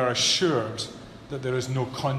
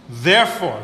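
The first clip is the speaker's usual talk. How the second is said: "Therefore" said louder with a firm fall; stressed "Therefore"; 7 dB louder than usual. Shown as a demonstration. Preacher stressing the conclusion word, louder. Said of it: "this is the implication".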